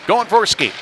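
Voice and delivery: high, loud burst on the rush; sudden excited play-by-play; excited call. Real, not acted. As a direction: lift the voice as the player takes off. Calls a player's rush.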